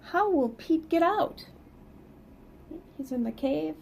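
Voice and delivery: plain reading voice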